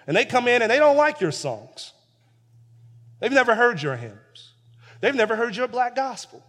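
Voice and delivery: fiery sermon reading; preaching at full force. Acted. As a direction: preach the story to the congregation. Preaching climax.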